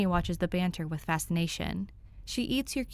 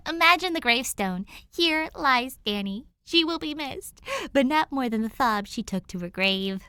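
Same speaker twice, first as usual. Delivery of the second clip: a higher, brighter-pitched girl's voice, playful and mock-solemn; Danny's voice; 6 semitones higher, 5 dB louder than usual. A narrator's reading. Danny jokes to Tim about her own gravestone, playful higher girl voice. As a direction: tease like reading your own epitaph. Narrator voicing Danny, teasing.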